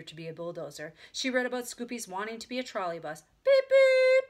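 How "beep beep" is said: very high, loud horn beeps; honking. A narrator's reading. Child imitates trolley bus, honking. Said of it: "honked Ramona".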